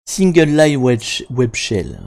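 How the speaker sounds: French-flavoured English accent, slowly; self-mocking French take on English accent; super English accent. Real, not acted. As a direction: show off a homemade English accent. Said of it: "avec mon super accent".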